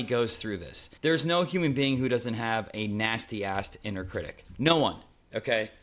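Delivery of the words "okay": usual speaking voice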